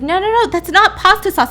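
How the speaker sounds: high, acted urgent protest; insistent correction. Office manager correcting the bleeding tenant, insistent.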